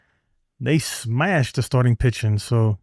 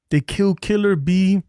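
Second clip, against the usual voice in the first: quoting a comedy film line, a little higher and slower; a light take on the Half Baked line; movie line quote; 6 semitones higher than usual. Retold or imitated. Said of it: "what movie that came from".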